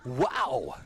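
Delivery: high, slow, loud preacher voice; hammed-up preacher, high and drawn out; Brother Judah preacher. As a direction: proclaim it like a revival preacher shouting to the pews. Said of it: "Then there's the Abdel Judah".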